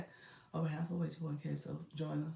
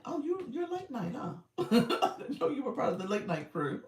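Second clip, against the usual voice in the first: higher and laughing, playful tease; teasing laugh; 7 semitones higher than usual. Real, not acted. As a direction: tease her through real laughter. Teasing a viewer about late nights.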